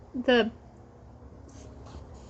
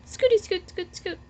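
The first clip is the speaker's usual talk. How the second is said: put-on reading to children; 5 dB quieter than usual. Teacher reading the Pigeon book aloud to her class. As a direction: read it out for little ones, pausing for them to echo.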